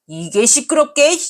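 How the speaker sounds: put-on drunk husband's sharp high yell; angry yell. Drunk husband silencing his wife, angry yell.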